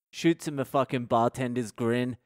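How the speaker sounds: ordinary delivery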